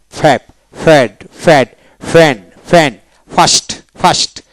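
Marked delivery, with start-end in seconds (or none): none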